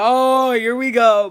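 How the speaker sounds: long, drawn-out mock groan; exasperated dread. Daughter called outside for a talk, exasperated. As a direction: stretch it out slowly in exasperation.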